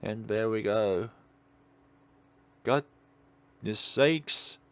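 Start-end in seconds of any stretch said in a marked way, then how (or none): none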